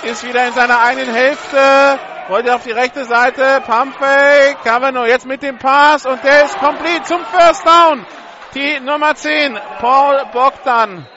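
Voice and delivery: rising to a loud excited shout; excited play-by-play. Real, not acted. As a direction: call the escape and pass with growing excitement. Pass play builds to first down.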